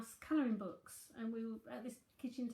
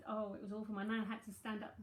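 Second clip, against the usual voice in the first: usual speaking voice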